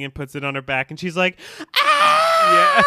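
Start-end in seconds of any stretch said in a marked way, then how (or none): 1.8-2.8 high, piercing, very loud shriek; dramatic scream. Retold or imitated. Recapping Joyce's scream when the alien lands, dramatic scream. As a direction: let out a huge hammy scream.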